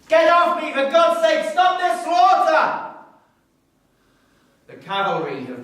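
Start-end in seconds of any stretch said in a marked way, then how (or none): 0.1-2.9 pleading shout. Acted. Dragged from platform, pleading.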